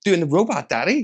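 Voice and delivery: put-on a child's cheerful reply; imitating daughter. Daughter answering dad on the ride, lightly childlike. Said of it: "It's like".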